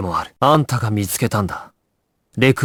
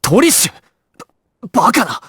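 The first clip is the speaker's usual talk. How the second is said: urgent shout; 9 semitones higher than usual. Acted. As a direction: yell her name in alarm. Desperate calling out in danger. (驚きの叫び)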